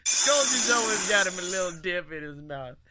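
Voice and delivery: muffled Southern voice with dip in mouth; Skull. Acted. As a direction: talk round a wad of dip, Southern accent. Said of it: "This is what Skull sounds like".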